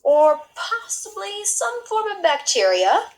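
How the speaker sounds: slow, high, lightly voiced expert; a hint of a fussy specialist offering a theory; expert's guess. A narrator's reading. Mister Mellon suggesting a diagnosis for Camilla, slow and high. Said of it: "said Mister Mellon".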